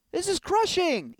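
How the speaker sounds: high, slow whine of dismay; hammed-up anguish; crushed wail. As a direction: wail it as if devastated. Upset at being blackmailed.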